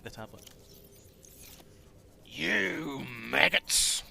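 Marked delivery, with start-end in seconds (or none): 2.3-4.0 very slow, drawn-out "You maggots"; slow Soldier voice. Retold or imitated. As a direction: stretch it out like the Soldier. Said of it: "almost as good as Lemmy at voices".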